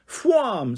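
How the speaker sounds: "Fwam" high and sharply falling, like a whoosh; the woman demonstrates the way to say "Fwam"; action-word exclamation. Acted. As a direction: fire "Fwam" off like a rocket leaving. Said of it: "said Emily".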